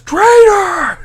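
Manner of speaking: very high, loud furious shout; yell. Retold or imitated. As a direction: hurl the word at the traitor, full force. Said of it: "And Kylo Ren just yells".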